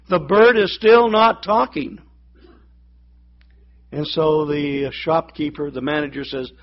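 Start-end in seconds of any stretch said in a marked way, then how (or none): 0.1-2.0 higher, whiny complaint; complaining tone. Retold or imitated. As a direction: complain that it still won't talk. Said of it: "in her complaining tone".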